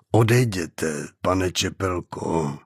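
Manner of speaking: low, measured de-escalating voice; slow, calm. A narrator's reading. Hostage playing negotiator to a gunman, slow and calm. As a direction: talk him down slowly, pitch dropped. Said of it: "řekl pomalu, hlasem tak klidným".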